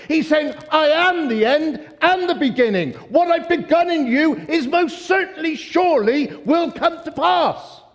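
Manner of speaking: rising, loud, fervent preaching; excited preaching. Real, not acted. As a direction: preach it with mounting fire. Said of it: "Excuse me for getting excited".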